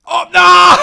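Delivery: sudden high yell of dismay, laughing; real shriek as the spin fails; dismayed shout. Real, not acted. Loses the jackpot spin.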